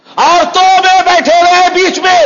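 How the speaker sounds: high, loud preacher's outcry; outraged shout. Real, not acted. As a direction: repeat it as a scandalized full-voice shout. Indignant repeat, denouncing from pulpit.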